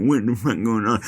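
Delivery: lightly voiced deaf father, words slurred together; imitating deaf dad. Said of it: "And he's like".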